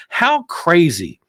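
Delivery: normal talking voice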